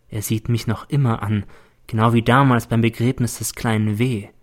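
ordinary delivery, nothing marked

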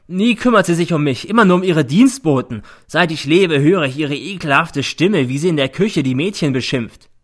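temper breaking out. A narrator's reading. Son denounces his mother to the court, furious. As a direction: pour out the grievance loudly. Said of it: "bricht aus".

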